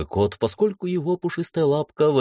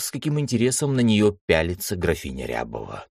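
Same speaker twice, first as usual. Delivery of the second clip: ordinary delivery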